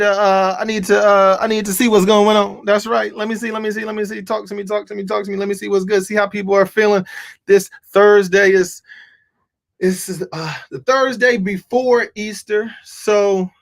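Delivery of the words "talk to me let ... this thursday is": ordinary delivery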